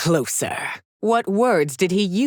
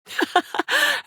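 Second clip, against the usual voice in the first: a very high, rising cackle; mocking laugh. Empress taunting her rival with a rising high laugh. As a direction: let a shrill taunting laugh climb.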